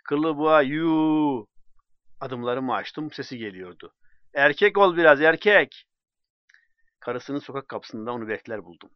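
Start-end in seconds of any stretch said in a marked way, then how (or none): 0.0-1.5 jeering yell. Acted. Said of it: "Ardımdan haykırdı". 4.3-5.9 higher, much louder taunt; mocking shout. Drunk man yelling taunts at friend walking away, loudly.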